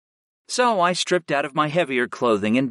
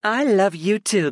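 higher, slower woman's voice; female character voice; 8 semitones higher than usual. Acted. Sarah answers Griff.

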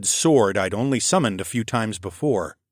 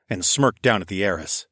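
ordinary delivery

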